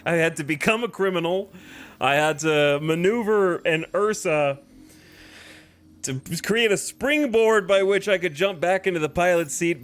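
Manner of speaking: loud, excited victory rant; triumphant. Real, not acted. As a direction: shout out the win, all worked up. Triumphant after hard maneuver.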